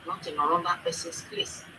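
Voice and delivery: ordinary talk, nothing marked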